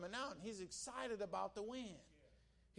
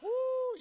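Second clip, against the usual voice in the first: sudden high whoop with a laugh; laughing holler; loud woo; 13 dB louder than usual. Retold or imitated. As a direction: let out a startled shout. Mimicking scary old church.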